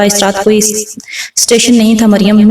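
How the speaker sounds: plain reading voice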